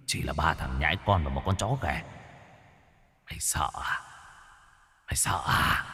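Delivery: soft, whispered, taunting inner voice; voice in his head. Acted. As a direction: tempt him in a ghostly whisper. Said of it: "giọng nói trong đầu lại vang lên".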